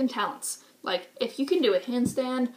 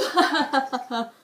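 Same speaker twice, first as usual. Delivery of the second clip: laugh; 3 semitones higher, 5 dB louder than usual. Real, not acted. Laughing after the mock voice.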